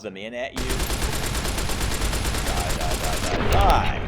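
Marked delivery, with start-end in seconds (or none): 2.4-4.0 loud rapid-fire battle cry; shouting, jumping high on the last word; combat yell. Real, not acted. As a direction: yell it at the enemy mid-fight. Yelling at enemy during combat.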